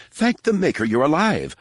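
high, fussy droid relief; anxious butler robot. A narrator's reading. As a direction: gush like a fretful protocol droid. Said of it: "the droid exclaimed".

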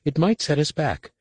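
high, insistent worry; arguing adept. A narrator's reading. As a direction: press the objection. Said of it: "Nenyim argued".

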